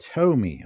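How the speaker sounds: Tomy as TOE-mee, falling at the end; long-o pronunciation. Shown as a demonstration. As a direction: say Tomy with "toe" as its first syllable. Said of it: "pronounced Tomy".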